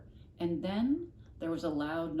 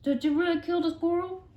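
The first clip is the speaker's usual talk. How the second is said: eager question; 11 semitones higher, 10 dB louder than usual. Real, not acted. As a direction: burst out with the question. Listener child asks aloud.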